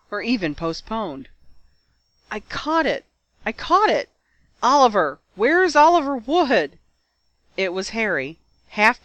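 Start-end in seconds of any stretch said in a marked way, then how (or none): 2.3-6.8 high, urgent triumphant cries; excited calling out. A narrator's reading. Harry on his stretcher calling Oliver, excited. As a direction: call out for your captain, elated.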